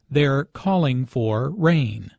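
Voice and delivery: ordinary talk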